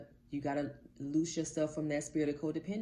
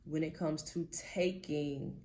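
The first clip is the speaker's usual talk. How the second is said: ordinary talk, nothing marked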